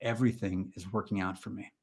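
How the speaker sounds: hushed mantra-leader delivery; mock affirmation. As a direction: recite it calmly after a deep breath. Leads a mock mantra exercise.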